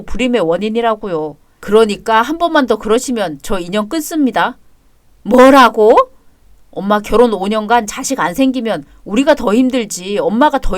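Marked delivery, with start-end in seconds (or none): 5.2-6.0 outraged shout. Acted. Mother hears threat to cut ties.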